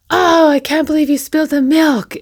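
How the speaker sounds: imitating a parent losing it over spilled milk; mock freak-out. Parent snapping at child over spilled milk, mock exasperation. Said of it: "I would freak out at him and go".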